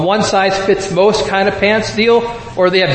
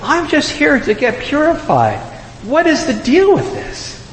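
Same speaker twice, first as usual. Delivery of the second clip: higher, mildly exasperated Joseph; baffled Joseph; 5 semitones higher than usual. Retold or imitated. Joseph confused at the temple, higher mock exasperation. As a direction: lightly play a puzzled new dad. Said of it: "imagine being Joseph going".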